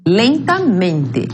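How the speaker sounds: "Lentamente" very slow, stressed on LEN and MEN; deliberate double accent on "Lentamente"; two stresses. Shown as a demonstration. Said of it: "por isso nós temos dois acentos prosódicos".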